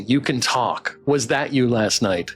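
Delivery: ordinary talk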